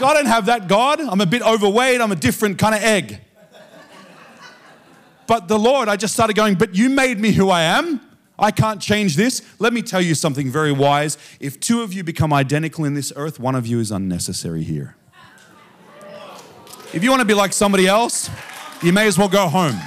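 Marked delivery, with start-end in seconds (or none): 0.0-9.4 high-pitched retold plea to God; impassioned preaching. Preaches, acting own complaint to God. 16.9-19.8 raised, punchy sermon challenge; forceful preaching. Real, not acted.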